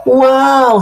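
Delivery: loud, high gasp of wonder; amazed wow. Acted. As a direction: marvel at the sight. Spider amazed at the vegetables.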